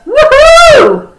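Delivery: high, loud "woohoo"; whooping cheer. Shown as a demonstration. Coach celebrating business success for viewers, excited whoop. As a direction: let out a big celebratory whoop.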